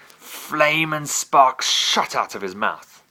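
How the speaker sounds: ordinary delivery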